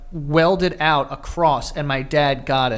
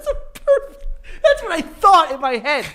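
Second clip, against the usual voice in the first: high, near-tearful laughter; crying with laughter mid-sentence; laughing hard; 13 semitones higher than usual. Real, not acted.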